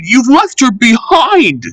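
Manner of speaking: high, far-off alarmed shout; a high alarmed cry from another engine. Acted. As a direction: call out the warning in a high voice. Engine shouts at departing train.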